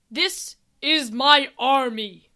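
loud, slow, stately announcement; army leader. Acted. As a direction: present your troops with slow weight.

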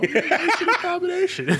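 high, teasing mock-sultry. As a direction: purr the name like a cheesy come-on. Mocking the suggestive name.

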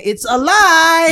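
slow, loud, laughing hammed-up exclamation; mock dramatic outcry. Joking that Jesus rises and cries out, hammed-up laughing outcry. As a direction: ham it up like a soap-opera reveal.

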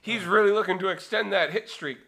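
play-by-play climbing to a high, loud call; baseball voice. Acted. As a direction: build like an excited commentator. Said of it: "think of the baseball voice".

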